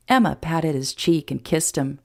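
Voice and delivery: usual speaking voice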